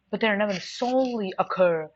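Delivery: ordinary talk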